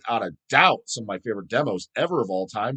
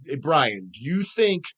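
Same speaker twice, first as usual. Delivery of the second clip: usual speaking voice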